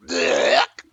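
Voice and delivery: loud, low spat blech; disgusted blech. Acted. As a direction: spit it out in disgust. Disgusted spit noise.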